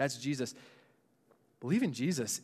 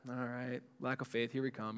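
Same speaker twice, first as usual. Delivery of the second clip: weary sigh; 4 semitones lower, 11 dB quieter than usual. Retold or imitated. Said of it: "he's like".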